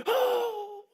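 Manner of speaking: high mock gasp of fright; startled gasp. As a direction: gasp like you just got spooked. Startled by the big guy.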